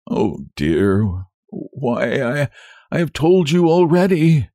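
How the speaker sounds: weary, halting groan of refusal; sick man worn down by pleading; exhausted doctor. A narrator's reading. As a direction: sigh it out in broken starts. Exhausted doctor pressed again.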